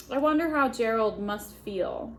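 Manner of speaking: ordinary delivery, nothing marked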